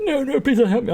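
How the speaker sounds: very high-pitched mock pleading; mock contrived plea. Streamer mimicking the character who switches, mock contrived plea. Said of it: "switches between being contrived and being alright".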